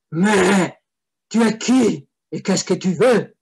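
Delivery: loud gruff demanding devil; third devil. Acted. Third devil questions the visitor, loud and gruff. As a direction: bark the question like a suspicious demon. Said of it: "dit la troisième voix".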